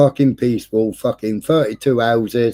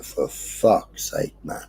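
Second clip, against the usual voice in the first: exasperated mutter; 4 semitones lower, 8 dB quieter, slower than usual. Retold or imitated. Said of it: "and it's like".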